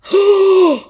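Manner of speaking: loud high outraged gasp; shocked gasp. Retold or imitated. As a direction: gasp as if the nerve of it stunned you. Outraged reaction to his reply.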